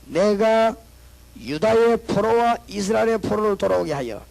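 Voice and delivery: loud, lifted proclamation of the verse; solemn scripture reading. Acted. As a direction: declare the verse as God's own word. (장엄한 성경 낭독)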